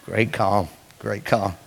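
hushed and soft, savoring great calm; soft hushed repeat. Shown as a demonstration. Preacher hushing the church with great calm. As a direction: breathe the words great calm gently. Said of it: "preacher softly repeats great calm".

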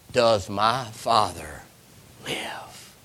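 soft slow question fading to a whisper; whispered plea. Acted. Joseph asking his brothers about their father, whispering. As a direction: ask it barely daring to hope.

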